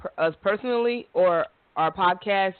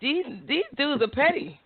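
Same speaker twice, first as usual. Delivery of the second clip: exasperated; 9 semitones higher than usual. Real, not acted. Exasperated complaint.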